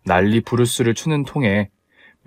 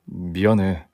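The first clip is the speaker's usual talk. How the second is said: low, soft male-role voice; apologetic fiancé; 5 semitones lower, 5 dB quieter, slower than usual. Acted. Fiancé apologises to angry bride, low and subdued.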